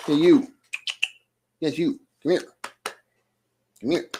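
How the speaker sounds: calling the cat to come. Real, not acted.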